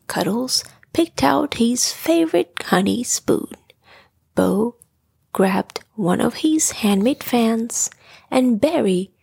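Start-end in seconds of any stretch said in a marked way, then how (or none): none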